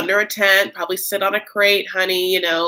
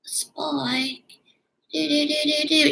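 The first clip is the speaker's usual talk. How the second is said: breathy whispered dreamy villain voice; high eerie sing-song whisper; imitating Drusilla; 9 semitones higher, slower than usual. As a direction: whisper it like an eerie vampire girl. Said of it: "She, like, talks like her".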